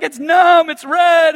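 put-on high and loud, a hurt kid; a kid wailing that his face hurts; crying kid. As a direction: mimic a little cousin crying in pain. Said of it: "and he's crying".

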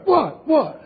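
high-pitched mock-innocent protest; feigned innocence. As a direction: play the guilty kid acting clueless. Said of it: "pretending as though they're doing nothing".

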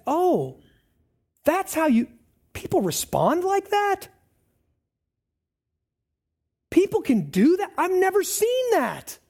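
mock amazement. Acting amazed onlookers at kindness.

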